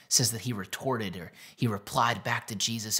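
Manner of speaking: ordinary delivery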